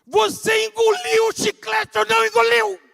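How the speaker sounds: very loud, high, pleading roar; desperate shout. Retold or imitated. Father demanding the truth from his lying son, shouting. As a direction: yell the final question with everything.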